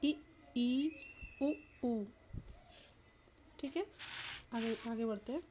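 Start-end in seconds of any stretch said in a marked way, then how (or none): 0.0-2.1 इ, ई, उ, ऊ separately, mouth nearly closed; short इ, long ई, short उ, long ऊ; close vowels. Shown as a demonstration. Teacher showing vowels made with mouth almost shut, close vowels. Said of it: "मुख द्वार बंद रहता है".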